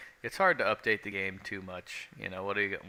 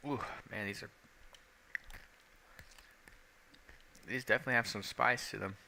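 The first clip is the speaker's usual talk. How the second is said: eating chips; 2 semitones higher, 4 dB quieter than usual. Real, not acted. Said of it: "the crunching".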